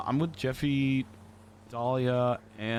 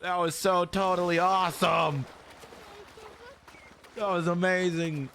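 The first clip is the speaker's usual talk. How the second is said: high, slow and awed; thrilled; 7 semitones higher, slower than usual. Real, not acted. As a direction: savour how awesome that was. Thrilled after the stunt.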